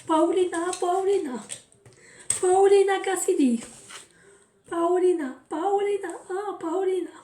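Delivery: put-on playful foreign accent, repeating Paulina. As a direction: call the name Paulina in an exaggerated foreign accent, pitched high. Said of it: "je fais cet accent".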